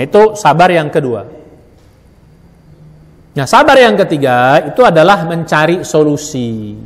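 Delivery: ordinary talk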